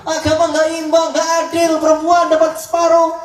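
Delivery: high-pitched indignant protest, imitated; mock modern complainer. Modern woman complaining religion is unfair, mock indignant. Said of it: "Sekarang kan begitu orang ngomongnya".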